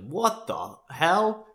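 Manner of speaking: drawn-out disbelief, Aussie style; Australian dad. Retold or imitated. Dad reacting to Michael's funeral talk, shocked Australian accent. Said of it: "He goes".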